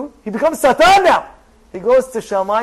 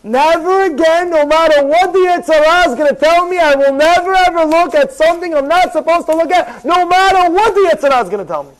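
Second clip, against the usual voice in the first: resolute vow; 4 semitones higher, 3 dB louder than usual. Acted. Firm vow against Yetzer Hara.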